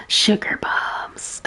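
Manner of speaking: slow, breathy whispered echo; amused whisper. Real, not acted. Streamer echoing the game character's phrase, slow amused whisper. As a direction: savor the words under your breath.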